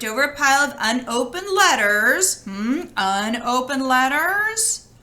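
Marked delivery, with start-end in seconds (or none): none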